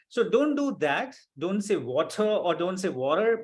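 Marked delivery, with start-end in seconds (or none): 1.7-2.2 British "water", no final r; British accent. Shown as a demonstration. Teacher warning student not to copy it, British accent. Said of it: "some British person". 2.9-3.4 American "water", r-coloured ending; American accent. Shown as a demonstration. Teacher warning student not to copy it, American accent. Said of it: "American, that person will say water".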